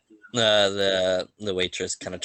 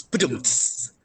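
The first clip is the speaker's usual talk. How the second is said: rimshot; 7 semitones higher than usual. Acted. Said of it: "he goes".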